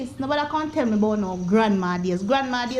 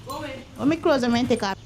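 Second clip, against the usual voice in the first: ordinary talk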